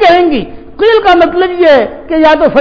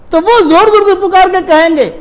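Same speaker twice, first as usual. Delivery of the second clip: ordinary talk, nothing marked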